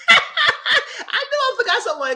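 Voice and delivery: high, drawn-out words through laughter; laughing while talking. Real, not acted. Storyteller admits forgetting someone last night, laughing through the words. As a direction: talk through the tail of a big laugh. Still laughing over own mistake.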